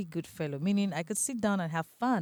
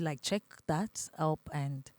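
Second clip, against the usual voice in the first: ordinary delivery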